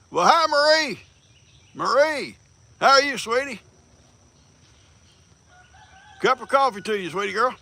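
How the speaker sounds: high, loud sing-song hello; sweet greeting. Real, not acted. Greeting viewer brightly.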